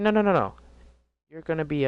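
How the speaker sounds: high, loud mock insistence; hammed-up principal talking over him; insistent principal. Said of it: "He's like".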